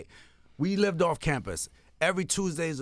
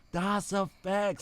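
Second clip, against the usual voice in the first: spelling out the name; 4 semitones higher, 4 dB quieter, much slower than usual. Retold or imitated. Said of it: "We like".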